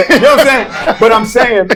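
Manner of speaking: talking through laughter, high and loud; embarrassed slip turned into loud, high laughter; laughing. Real, not acted. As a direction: laugh as you try to get back on track. Laughs after his slip about wife.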